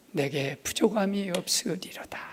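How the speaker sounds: hushed, breathy recital of the verse; whispered psalm. Acted. Pastor whispering the psalm to the congregation. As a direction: let the verse fall to a whisper.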